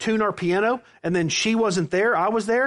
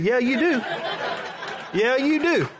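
emphatic mock retort; 4 semitones higher than usual. Retold comic retort as pastor leaves.

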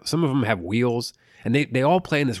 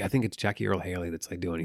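usual speaking voice

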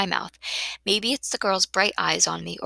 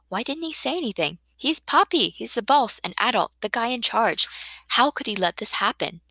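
higher, worked-up protest; indignant; 4 semitones higher than usual. Acted. Daughter about Poppy, the boss who said nothing, indignant. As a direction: burst out in indignant disbelief.

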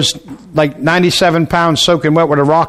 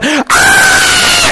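very high, long mock scream; imitated scream. Women having babies down the hall, imitated screaming. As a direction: shriek like a labor ward. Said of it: "they're screaming".

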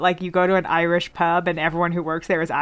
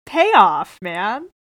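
mock catchphrase; 5 semitones higher, much slower than usual.

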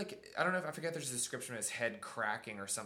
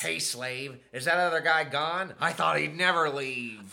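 loud, menacing dummy voice; Slappy; 3 semitones higher, 7 dB louder than usual. Acted. Dummy grabs her arm and speaks, loud villain voice. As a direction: snarl it loud, stretching the final words. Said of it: "Slappy grabs her arm and says".

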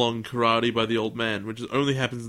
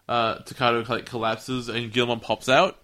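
normal talking voice

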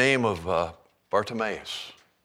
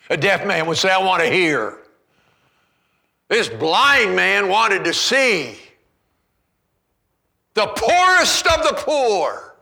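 preaching at full force; 10 semitones higher, 10 dB louder than usual. Real, not acted. Preaching climax on the beggar.

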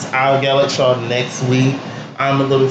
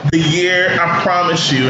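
normal talking voice

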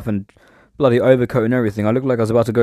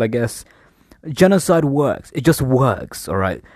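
normal talking voice

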